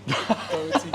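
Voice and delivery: high, loud, laughing protest; cracking up while talking. Real, not acted. Guest teased over botching his friend's name, laughing protest.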